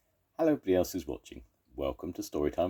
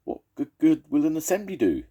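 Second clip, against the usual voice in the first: high, louder squeak; squeaky rabbit; 13 semitones higher, 6 dB louder, faster than usual. Acted. Little rabbit questioning the elders, squeaky. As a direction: squeak it bravely like a tiny rabbit. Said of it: "squeaked a brave little rabbit".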